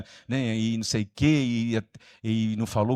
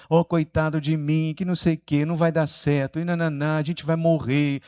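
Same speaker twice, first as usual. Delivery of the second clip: higher-pitched mock complaining; grumbling cartoon hyena; 5 semitones higher than usual. Preacher imitating the cartoon hyena, grumbling and complaining. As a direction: play the doom-laden hyena moaning in self-pity. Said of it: "reclamava, murmurava, resmungava".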